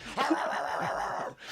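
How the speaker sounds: loud, high run of mock barks; imitated dog barking. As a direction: bark like a pack of German shepherds. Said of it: "I hear German shepherds barking".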